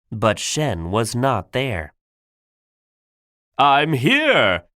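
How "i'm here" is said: loud storybook call; shout. Acted. Shen calls to Po from the cannons, shouting. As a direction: call out forcefully, voice leaping up. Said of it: "shouted Shen".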